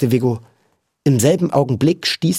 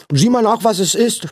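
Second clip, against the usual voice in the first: put-on higher boy's voice with mouth full; 10 semitones higher than usual. A narrator's reading. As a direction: talk through a mouthful of bread. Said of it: "den Mund voll Brot". (mit vollem Mund gesprochen)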